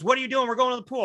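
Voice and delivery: high, mock-impatient mother; impatient mom. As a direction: hurry the kid along, pitched high. Mom calling son to the pool.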